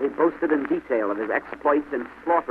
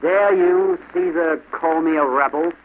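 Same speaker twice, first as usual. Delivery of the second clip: loud, high-pitched intimidation; threatening cry; 5 semitones higher, 5 dB louder, slower than usual. A narrator's reading. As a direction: challenge the boy menacingly. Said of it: "he cried".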